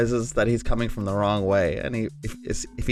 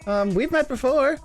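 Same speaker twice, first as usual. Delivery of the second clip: much higher pitched hero voice; high character voice; 12 semitones higher than usual. Acted. Player voices Kalo in character.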